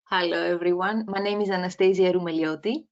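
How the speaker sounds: usual speaking voice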